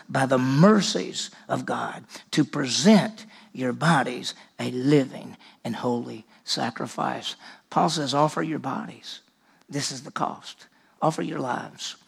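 low, soft, slow preaching of the cost; quiet preaching. Acted. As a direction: bring it down to an earnest hush. Quiet intense scripture close.

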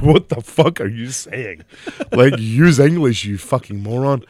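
retold exasperated scolding; mock exasperation. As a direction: lay into him word by word, incredulous. Retells his exasperated reply to brother.

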